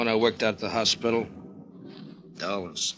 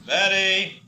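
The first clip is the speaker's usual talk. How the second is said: raised call to the next room; 10 semitones higher than usual. Acted. Husband calls his wife from the living room, raised voice.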